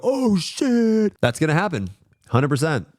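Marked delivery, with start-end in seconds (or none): 0.0-1.1 much higher, awed gasp; hammed-up wow; mock amazed gasp. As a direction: play the stunned fan. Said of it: "people are gonna go".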